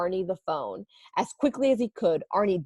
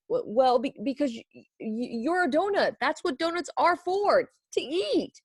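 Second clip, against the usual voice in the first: nervous stammer; 7 semitones higher than usual. Acted. Bing stammers excuses.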